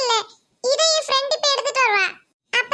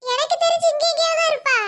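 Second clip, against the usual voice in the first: ordinary talk, nothing marked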